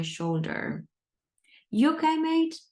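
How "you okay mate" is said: a hint of a concerned Australian man, higher; friendly check-in, pitched up; lightly voiced Aussie mate. Kind Australian guy asks panicking speaker.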